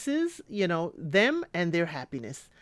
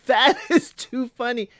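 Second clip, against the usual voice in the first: laughing; 4 semitones higher, 4 dB louder than usual. Retold or imitated. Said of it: "I was like".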